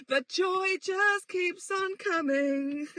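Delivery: slow, high, sing-song sarcasm; mock cheerful, slowed right down; sarcastic. Real, not acted. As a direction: drip dry irony over it. Sarcastic remark about wisdom tooth.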